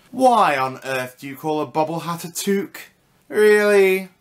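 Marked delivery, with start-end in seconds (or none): 3.3-4.1 incredulous. Real, not acted. Exasperated at Canadian word.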